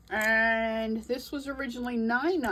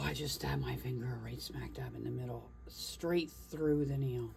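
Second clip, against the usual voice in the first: pained whisper; 9 semitones lower, 9 dB quieter than usual. Real, not acted. Just stabbed finger, pained whisper.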